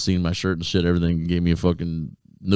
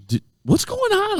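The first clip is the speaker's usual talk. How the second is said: very high, drawn-out mock bewilderment; baffled; slower than usual. Asking friends why people are mad, high baffled voice. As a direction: squeak it out slowly in confusion. Retold bewilderment at strangers' hostility.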